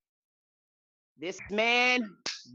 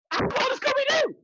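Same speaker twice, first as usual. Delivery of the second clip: excited; 14 semitones higher than usual. Shown as a demonstration.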